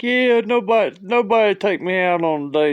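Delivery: high, loud comic lament; hammed-up tearful self-pity; mock bawling. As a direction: imitate a woman wailing her complaint. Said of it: "started crying and just bawling".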